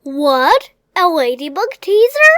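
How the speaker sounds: loud, quick, puzzled question; baffled at the strange word; surprised. Acted.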